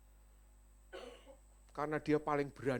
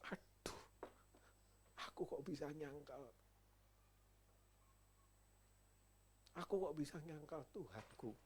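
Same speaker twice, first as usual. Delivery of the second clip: remorseful lament; 10 dB quieter than usual. Acted. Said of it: "Petrus nangis".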